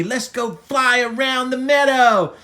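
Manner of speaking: rally a friend with excitement; eager urging. Acted. Buttercup inviting Yodi to fly, eager loud call.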